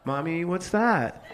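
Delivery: put-on child asking Mommy. Voicing a child asking mother.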